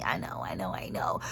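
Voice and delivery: low and soft, almost whispered; hushed concession. Real, not acted. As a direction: quietly take it back. Taking back the joke.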